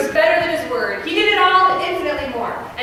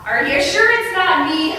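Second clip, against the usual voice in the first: ordinary talk, nothing marked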